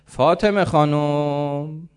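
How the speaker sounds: loud, high, long-drawn call. Acted. Old man calling the youngest daughter for water, drawn-out calling. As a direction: call the name out slowly, stretching it. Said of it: "صدا زدم که دختر سومی".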